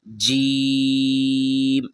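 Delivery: jeem, slow and drawn out; letter name demo. Shown as a demonstration. Teacher drawing out a letter name for pupils. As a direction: hold the letter name long for the class. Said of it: "اب چلتے ہیں ہم دوسرے line میں".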